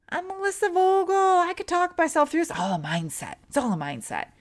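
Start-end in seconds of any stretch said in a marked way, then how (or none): 0.1-2.5 high sing-song mock bravado; mock self-confidence. Storyteller mimicking her overconfident self before the MRI, mock cocky. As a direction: puff yourself up in a silly high voice.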